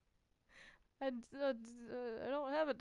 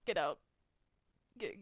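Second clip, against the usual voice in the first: ordinary delivery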